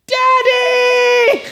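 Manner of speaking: high-pitched shriek of Daddy. Retold or imitated. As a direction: shriek for daddy like a spoiled bride. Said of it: "she turns and goes".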